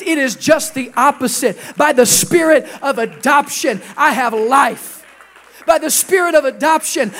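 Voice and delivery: impassioned declaration, swelling to loud; full-force preaching. Real, not acted. Preacher declaring his adoption to the congregation, impassioned. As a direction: build to a loud sermon climax.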